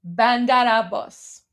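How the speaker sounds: "Bandar Abbas" drawn out syllable by syllable, Persian vowels; slow repeat-after-me. Shown as a demonstration. Leading the audience in repetition, slow and careful. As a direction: say "Bandar Abbas" slowly so viewers can copy. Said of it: "Say it with me".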